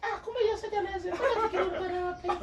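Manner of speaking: high, fast, laughing mimicry; mimicked former sneerer. Stranger who sneered now chatting up the guest, high and giggly. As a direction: imitate them in a quick high laughing voice.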